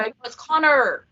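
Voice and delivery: Connor with hard r at the end; American Connor. Retold or imitated. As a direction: say Connor the American way, rolling the final r. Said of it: "And they go".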